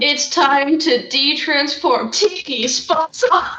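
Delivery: high, slow, sing-song transformation call; magical girl transformation. Pretend. Kitsune player mock-calling out a magical-girl transformation. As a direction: announce the change like a cartoon heroine.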